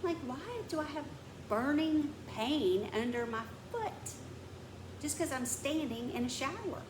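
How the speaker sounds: high, incredulous griping; exasperated complaint. Real, not acted. Venting about foot pain in the shower, exasperated.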